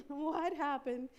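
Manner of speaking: drawn-out, high, loud disbelief; shocked question. Retold or imitated. Retelling her shocked question to John, slow and loud. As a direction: stretch it out in amazement.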